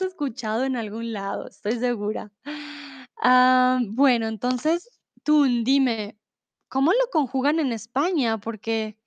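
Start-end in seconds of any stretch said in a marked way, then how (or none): none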